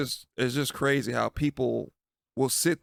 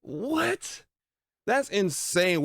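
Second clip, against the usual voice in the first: high, rising squeal of disbelief; a much higher, questioning "what?"; incredulous; 6 semitones higher, much slower than usual. Real, not acted. Reacting to her having to apologize, incredulous.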